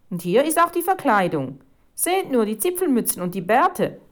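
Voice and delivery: high, loud delight; enthusiastic exclamation. A narrator's reading. Reporter discovering the gnome disguises, enthusiastic. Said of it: "rief Felicitas Schneider begeistert aus".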